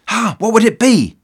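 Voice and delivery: high-pitched, hammed-up eager question; mock suspense. Listener awaiting the Scotsman's verdict, in mock suspense. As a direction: play the listener agog for the answer. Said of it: "The tension mounted as it became clear".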